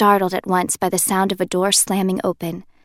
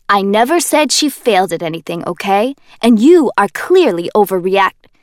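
loud defensive protest; Kenji, protesting; 7 dB louder than usual. Acted. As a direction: backpedal in a hurry.

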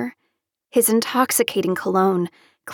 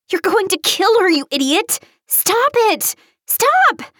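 high frantic screams, climbing higher; screaming; 11 semitones higher, 3 dB louder than usual. A narrator's reading. Taylor hitting the man holding her friend, screaming. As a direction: shriek at him to quit. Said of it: "Taylor continued to scream".

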